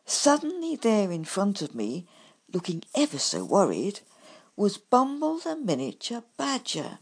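ordinary delivery, nothing marked

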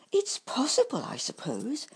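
a light high shrug of a badger; easy offhand guess; shrugging. A narrator's reading. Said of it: "said Bumble with a shrug".

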